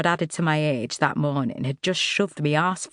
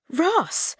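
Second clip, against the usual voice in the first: sudden high cry of recognition; surprised exclamation; 12 semitones higher than usual. Acted. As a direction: blurt the name in surprise. Recognises old friend, exclaims.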